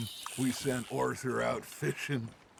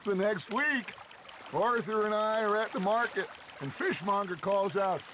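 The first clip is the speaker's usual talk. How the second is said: loud, high build to a punchline; boisterous, high-pitched anecdote; gleeful teasing; 6 semitones higher, 4 dB louder than usual. Acted. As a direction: tell it with relish, voice raised. Gleefully reaching the story's punchline.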